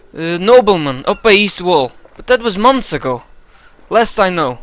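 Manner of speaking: frightened informant answering the steward; stammering informant. Acted. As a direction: stammer out the excuse.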